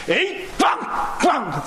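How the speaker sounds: high shouted bang noises; striking sound effect. Acted. Pastor mimicking Moses hitting the rock, shouted bangs.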